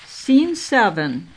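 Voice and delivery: ordinary delivery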